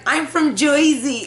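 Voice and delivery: high, slow mock Jersey accent. Niece shows off her one line, Jersey accent. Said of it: "she does a good Jersey accent".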